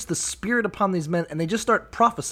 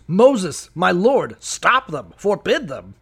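louder, pressing appeal to his master; urgent plea; 3 semitones higher, 6 dB louder, slower than usual. Acted. Joshua urging Moses to forbid prophets, loud plea. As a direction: beg Moses to stop them, urgently.

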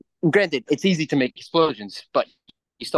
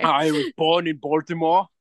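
Schwarzenegger impression; 2 semitones higher than usual. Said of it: "be like fucking Schwarzenegger".

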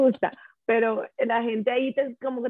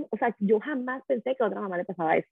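usual speaking voice

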